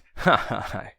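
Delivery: short acted laugh as Marcus; mock laugh. Marcus laughs at his crying sister, a short mock laugh. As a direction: give a brief mocking chuckle. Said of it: "Marcus ridet".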